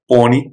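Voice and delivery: "Poni" with a rounded "aw" vowel; full-mouth pronunciation. Shown as a demonstration. Repeating Gujarati variant for students, full-mouth "Poni". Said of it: "it's more full mouth".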